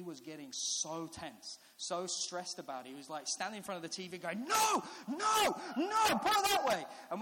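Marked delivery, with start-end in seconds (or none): 4.4-6.9 high, full-voiced imitated yell; loud, stretched, pleading cries of a small boy; distraught child shouting. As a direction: shout at the telly like a panicking kid. Imitating tense son at TV.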